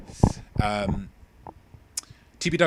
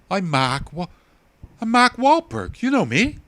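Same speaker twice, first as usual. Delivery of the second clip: much higher, loud, slow Boston tough-guy voice; Mark Wahlberg impression; 10 semitones higher, 9 dB louder than usual. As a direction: belt it out like Mark Wahlberg, hammed up. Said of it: "Something like that".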